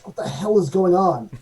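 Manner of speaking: mildly acted indignant captain; ship's captain. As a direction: demand answers loudly as the captain. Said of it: "and she says".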